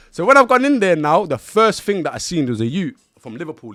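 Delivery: building up to the big moment; rising, loud telling; heated. Real, not acted. Building tension in story.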